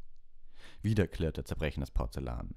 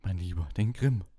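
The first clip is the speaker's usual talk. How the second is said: higher, louder insistent seer; calling out; 5 semitones higher, 5 dB louder than usual. A narrator's reading. Trelawney to Harry, shocked, calling out louder. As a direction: exclaim it, shocked he doesn't get it. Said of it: "rief Professor Trelawney".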